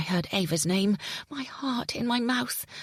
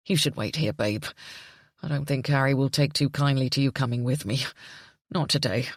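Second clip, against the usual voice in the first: distraught father relaying the news; Jimmy, distraught; 7 semitones lower than usual. A narrator's reading. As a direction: lower male voice, shaken. Jimmy voiced.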